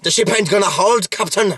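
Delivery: high, hammed-up Scottish accent; mock Scottish engineer shouting to the captain; Scotty impression. Mocking Scotty's accent while recapping Star Trek, hammed-up Scottish. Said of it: "there's Scotty".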